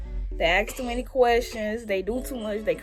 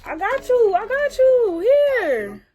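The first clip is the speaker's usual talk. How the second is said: excited; 13 semitones higher, 8 dB louder, much slower than usual. Real, not acted. Handing over chicken on camera.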